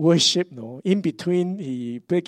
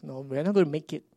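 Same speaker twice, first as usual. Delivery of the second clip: usual speaking voice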